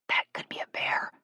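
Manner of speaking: soft, wary whisper. A narrator's reading. Shay warning Jill of a noise, whispered. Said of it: "Shay whispered".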